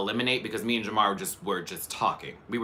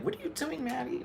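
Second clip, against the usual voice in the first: puzzled viewer; 14 semitones higher, 9 dB quieter than usual. Retold or imitated. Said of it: "that was like".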